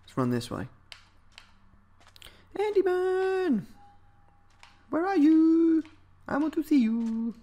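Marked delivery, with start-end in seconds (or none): 2.6-7.3 high, slow, stretched calling-out voice; sing-song call. Pretend. Gamer calls out across the map for an Enderman, high and drawn out. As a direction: call out high like looking for a lost pet.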